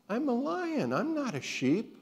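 high, thrilled declaration; excited. Acted. Lion realising he is no sheep, high and thrilled. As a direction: burst out with joy.

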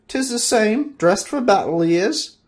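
wizard forcing confidence. Acted. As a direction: sound sure though he isn't. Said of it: "trying to sound confident".

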